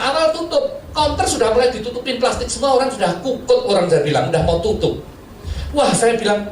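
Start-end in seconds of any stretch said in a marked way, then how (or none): none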